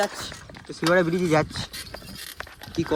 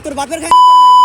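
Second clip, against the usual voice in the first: furious shout; 5 semitones higher, 13 dB louder, faster than usual. Real, not acted. A biker yelling at the man who hit him, furious shout. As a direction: yell it at full volume, outraged. Heated fight, defiant shout.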